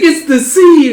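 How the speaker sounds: loud, high-pitched thrill; excited little kid. Acted. Boy seeing the sea, excited like a kid. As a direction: marvel like an excited child. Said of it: "also excited like a little".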